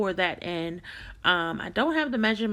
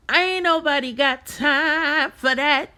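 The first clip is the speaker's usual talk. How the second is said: meme quote; 6 semitones higher, 6 dB louder, slower than usual. Retold or imitated.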